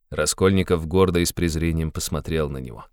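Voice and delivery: ordinary delivery, nothing marked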